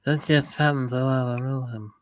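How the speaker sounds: soft, low Brando murmur; a hint of the Godfather, mumbled; Brando impression. Repeating the Brando line to his co-host, low. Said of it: "That's a good Brando".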